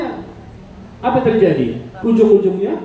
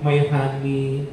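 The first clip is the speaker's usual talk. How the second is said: usual speaking voice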